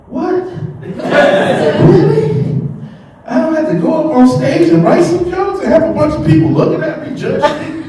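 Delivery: high, laughing mock amazement; stunned, can't believe the easy money; amazed disbelief. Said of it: "And I was like".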